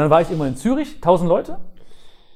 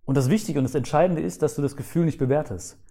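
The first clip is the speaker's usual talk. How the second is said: ordinary talk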